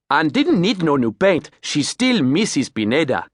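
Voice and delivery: cheeky Creole captain with stress on still; grinning Creole accent. Acted. Captain joking about the paint, Creole accent. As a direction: grin through it in a Creole accent. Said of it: "He grinned".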